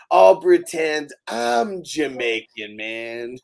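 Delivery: slow put-on Jamaican "man"; mock Jamaican accent. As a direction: draw it out in a fake Jamaican lilt. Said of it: "The Joker is [name]".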